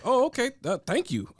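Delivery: high, loud hammed-up Eastern European accent; mock Eastern European accent. The immigrant gratefully accepting the land, in a mock Eastern European accent. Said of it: "Do that accent again".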